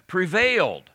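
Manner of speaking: PREVAILED punched high and loud, falling at the end; "prevailed" proclaimed high and forceful; emphatic stress. Shown as a demonstration. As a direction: hit "prevailed" as the key word. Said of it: "that has to have the emphasis".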